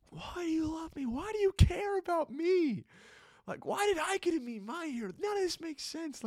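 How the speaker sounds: high, loud, overwhelmed pleading; screaming my head off. Retold or imitated. An emotional man screaming to Jesus on a bench, loud. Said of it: "I was just screaming my head off".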